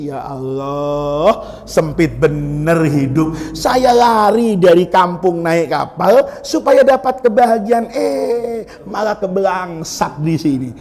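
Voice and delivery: Yunus moaning, low and slow; low slow lament. Retold or imitated. Preacher voices Yunus lamenting on the ship, low and slow. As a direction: drag the words down in despair.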